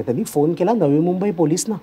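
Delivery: ordinary talk, nothing marked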